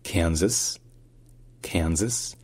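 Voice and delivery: "Kansas" as KAN-zas, stress on KAN, first s like z; "Kansas" with a clear z in the middle and a sounded final s; American Kansas. Shown as a demonstration. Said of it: "ça se dit".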